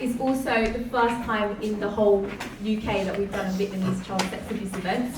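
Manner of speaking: ordinary delivery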